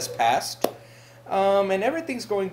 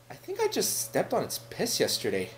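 ordinary talk, nothing marked

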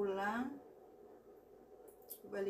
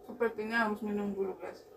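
talking while chewing; dry food in mouth; mouth full of egg; 9 dB louder, faster than usual. Real, not acted. Seen 0: Bit egg earlier, still chewing with bulging lips while talking.

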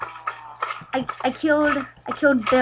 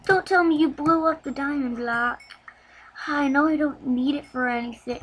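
normal talking voice

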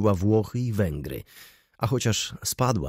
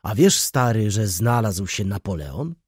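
ordinary delivery

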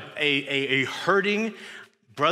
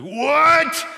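a sudden, much higher and louder "What?"; baffled shout; 11 semitones higher, 10 dB louder than usual. Retold or imitated. Disciples shout back at Jesus in stunned disbelief.

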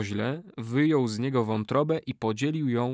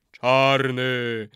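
chorus response; 4 dB louder than usual. A narrator's reading. Warriors echo the curse, droning chant. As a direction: drone it back.

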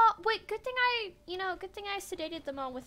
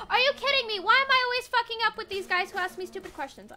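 loud, higher frustrated rant; exasperated outburst; 5 semitones higher, 11 dB louder than usual. Real, not acted. Streamer ranting at the game after another wrong answer. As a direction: vent at getting it wrong again.

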